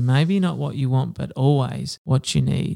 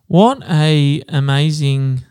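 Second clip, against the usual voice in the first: usual speaking voice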